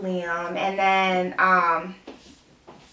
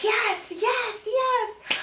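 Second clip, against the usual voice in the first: overjoyed; 3 dB quieter, much slower than usual. Retold or imitated. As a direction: squeal softly with mock delight. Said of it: "so super happy".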